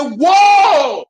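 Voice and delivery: long, high shout of delighted amazement; overjoyed whoop re-enacting sudden good luck; happy exclamation. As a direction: shout it out high and drawn out, thrilled. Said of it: "I was so happy".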